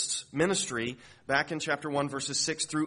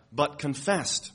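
normal talking voice

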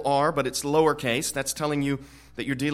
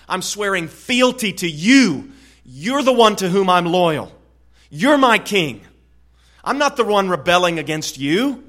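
loud oath of loyalty; 3 semitones higher, 9 dB louder than usual. Acted. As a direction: proclaim loyalty to the king with full force. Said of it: "He's saying".

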